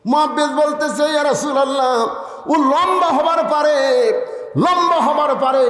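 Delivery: high, ringing sermon storytelling; full-force preaching. A narrator's reading. Preacher telling the crowd of two children running to the Prophet, high impassioned delivery.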